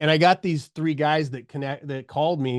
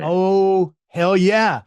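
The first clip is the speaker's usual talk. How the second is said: eager "hell yeah", pitched up and louder; excited; 5 semitones higher, 7 dB louder, much slower than usual. Retold or imitated. Grabbing the speaking job his boss offers, excited yes. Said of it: "And I was like".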